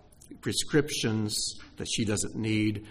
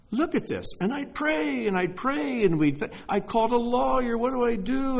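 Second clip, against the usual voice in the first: much higher, pleading recollection of despair; retold desperation; 12 semitones higher than usual. Preacher retells praying over his ruined house, high and desperate. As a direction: relive the frantic prayers in a high voice.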